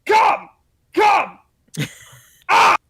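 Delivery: hammed-up climax yells. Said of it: "something he just yells".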